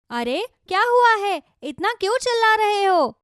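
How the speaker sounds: high-pitched, questioning wife's voice; startled wife. Acted. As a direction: call out high, wanting to know what happened. Wife runs in, alarmed.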